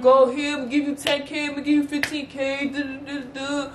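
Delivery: mock begging with gifts; a light hint of a pleading boyfriend; imitating apologetic boyfriend.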